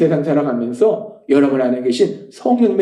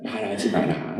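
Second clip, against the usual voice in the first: very low, hushed command; quiet, deep divine reply; God's inner voice; 9 semitones lower, 11 dB quieter than usual. Retold or imitated. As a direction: answer softly as God within. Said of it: "그럼 대부분 하나님이".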